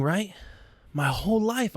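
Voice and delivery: normal talking voice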